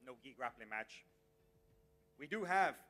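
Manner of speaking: ordinary talk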